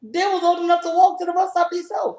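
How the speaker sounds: arguing loudly with her partner; heated retort. Real, not acted. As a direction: snap back in the heat of the argument. Arguing, high loud.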